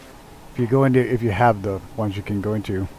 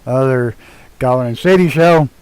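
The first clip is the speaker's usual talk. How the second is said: loud, bright welcome to the stream; show opener; 2 semitones higher, 8 dB louder than usual. Acted. Host greets viewers opening the stream, loud welcome.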